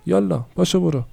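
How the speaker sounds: a mother mocking then dismissing her daughter; scornful shooing. (تحقیر و بیرون کردن)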